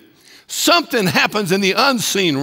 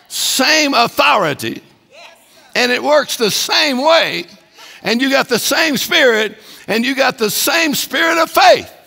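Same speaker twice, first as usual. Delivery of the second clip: building preaching; 6 semitones higher than usual. Acted. Pastor rallying the congregation about the spirit of faith, building preaching. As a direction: build to the climax line by line.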